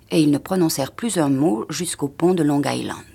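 usual speaking voice

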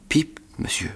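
tiny scared whispered answer; frightened whisper. A narrator's reading. Terrified boy whispering his name to the convict. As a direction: breathe it out in fear.

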